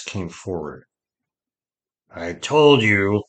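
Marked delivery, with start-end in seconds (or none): 2.1-3.2 dry, slow and louder; a dry, deliberate told-you-so; dry-voiced. A narrator's reading. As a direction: say it slowly and dryly, firmly projected. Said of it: "said dry-voiced to his lady".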